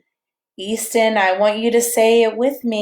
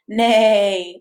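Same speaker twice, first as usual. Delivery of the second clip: horse neigh; 4 dB louder than usual. Shown as a demonstration. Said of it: "Can you make a horse sound with me".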